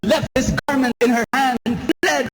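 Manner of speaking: jubilant preaching. Real, not acted. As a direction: lift it to a hallelujah. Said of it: "Brothers and sisters, I have good news".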